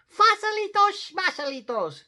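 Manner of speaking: very high, loud little-dog voice; declaring tiny Chihuahua. A narrator's reading. Smallest Chihuahua dismisses the fossils, declaring. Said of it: "declared Poquito Tito".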